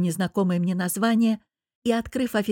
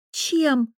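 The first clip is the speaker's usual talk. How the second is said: higher, huffy one-word challenge; scornful snort; 7 semitones higher than usual. A narrator's reading. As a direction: toss it out with a huff. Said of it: "фыркнула я". (фыркнув, с вызовом)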